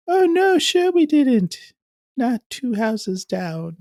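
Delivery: high, slow cackling witch sneer; sarcastic wife as a wicked witch, drawn out. Retold or imitated. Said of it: "You sound like a wicked witch".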